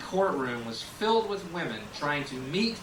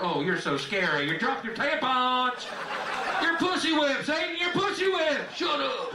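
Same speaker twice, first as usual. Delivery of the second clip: mocking taunt; 10 semitones higher than usual. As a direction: jeer at him like a schoolyard bully. Taunting Satan over tampons.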